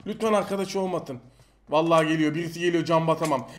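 ordinary delivery

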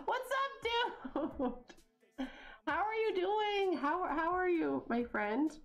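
very high, drawn-out hello; effusive greeting. Pretend. Gamer greets friend mid hissy fit, effusive.

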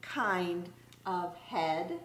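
lively, singsong picture-book read-aloud; reading to children. Acted. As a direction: read it like story time for little kids.

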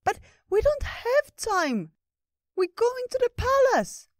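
very high, loud, slow, anxious little creature; anxious squirrel. Acted. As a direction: blurt out the worry in a tiny high voice. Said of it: "Ratatosk got anxious".

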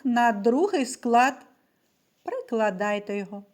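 ordinary talk, nothing marked

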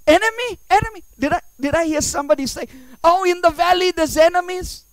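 loud, high-pitched startled questioning; mock alarm. Hearing someone say enemies in the valley, mock alarm. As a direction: react as if a cry of danger just reached you.

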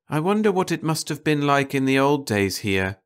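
ordinary delivery

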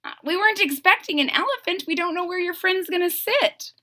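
lightly voiced queen fretting; fussy queen. The queen frets to the king about the elephant. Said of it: "the queen is like".

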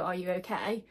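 ordinary talk, nothing marked

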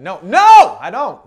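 sudden loud, joking denial; emphatic denial. Acted. Pastor denying he tracks her by drone, emphatic denial.

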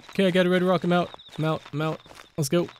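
ordinary delivery

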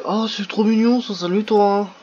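attendri et ralenti, plus aigu et plus fort, comme à un petit animal; s'extasier lentement devant une bestiole mignonne; voix attendrie. Real, not acted. Parle à une créature mignonne. (voix gaga pour un bébé animal)

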